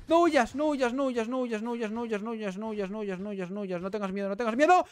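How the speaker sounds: high, frantic chant fading, then rising again; breathless repeated begging, surging at the end; frantic urging. Real, not acted. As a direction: plead urgently in a rapid loop. Urging last enemy not to flee.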